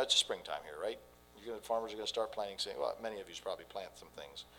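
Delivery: ordinary delivery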